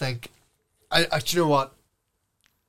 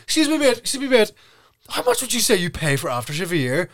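mock interviewer; 6 semitones higher, 4 dB louder than usual. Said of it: "he's like".

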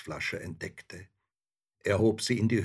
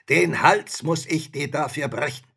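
loud, raised menace; threatening spirit; 6 semitones higher, 8 dB louder than usual. A narrator's reading. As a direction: deliver a loud threat as a monster.

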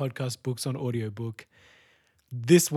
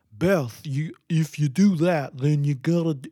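mock Russian accent. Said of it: "everyone was like".

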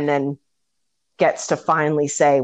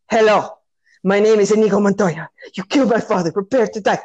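gain strength with every line; building repetition; 4 semitones higher, faster than usual. Retold or imitated. Inigo's line, repeated stronger.

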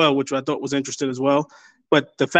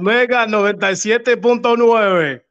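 slow, higher radio DJ call; Spanish radio announcer; 5 semitones higher, slower than usual. Acted. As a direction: sell the station like a Latin DJ. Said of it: "I feel like I'm listening to La Mega".

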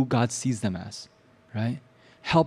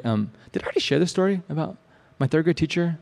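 normal talking voice

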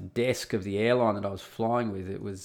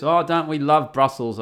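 mock adoration, raised and loud; higher, louder sarcastic retort; 4 semitones higher, 5 dB louder than usual. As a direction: gush it with obvious sarcasm. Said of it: "The best sarcastic voice I can possibly do".

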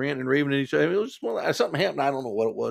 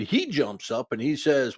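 ordinary talk

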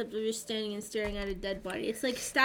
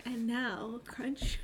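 slow, whispered ASMR voice; 8 dB quieter, much slower than usual. Pretend. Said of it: "This is A S M R".

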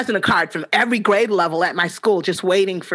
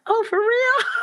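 mock surprise; 14 semitones higher than usual. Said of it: "I'm like".

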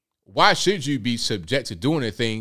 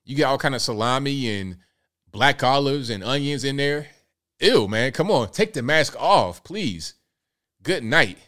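ordinary talk

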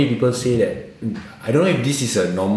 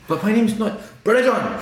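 mock protest; 13 semitones higher than usual. Recruit replying, mock protest.

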